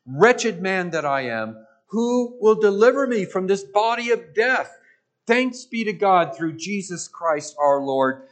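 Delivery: loud, rising scripture proclamation; loud preacher's declaration of Paul's words; fervent preaching. Acted. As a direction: cry the verse out to the congregation. Reads Romans aloud, preaching.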